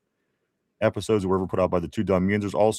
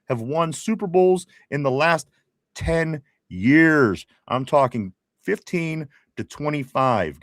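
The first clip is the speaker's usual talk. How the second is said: higher-pitched, building trivia announcer delivery; rally everyone for the big question; game-show host hype; 7 semitones higher than usual. Acted. Host calling board and viewers to trivia, hyped announcer.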